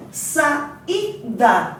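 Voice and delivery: "Saída" broken slowly into sa, í, da; syllable by syllable. Shown as a demonstration. Teacher showing the hiato to the class, syllable by syllable. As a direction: separate the í in "Saída" as its own syllable. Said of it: "pronunciados uma numa sílaba".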